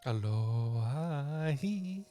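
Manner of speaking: slow drawn-out moaning aloha; zombie moan. Pretend. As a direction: moan it like the undead.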